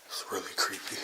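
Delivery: scared hushed whisper. Real, not acted. Alone in dark basement, scared.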